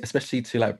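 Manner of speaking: ordinary delivery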